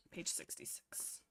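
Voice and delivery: breathy whispered aside. Real, not acted. Reader whispering a page number.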